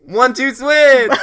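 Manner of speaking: loud, high, stretched-out call; gleeful launch shout. Pretend. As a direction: yell it slowly as the countdown climax. Joining the mock countdown.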